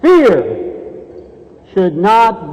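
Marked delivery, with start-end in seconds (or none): 0.0-0.6 emphatic fear. Acted. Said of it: "dramatic keyword".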